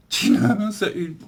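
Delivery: incredulous laughing question. Real, not acted. Laughs at absurd doctor remark.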